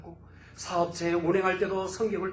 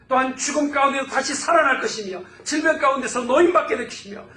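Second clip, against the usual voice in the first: loud, high proclamation, stacking promise on promise; full-force preaching; 8 semitones higher, 10 dB louder than usual. Acted. Pastor proclaiming God's promised revival to the congregation, loud and high. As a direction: proclaim it like a prophecy over the church.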